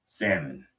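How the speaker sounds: salmon said as SAM-mon, slowly; silent L. Shown as a demonstration. How Alaskans all say it, salmon with silent L. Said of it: "they all say salmon".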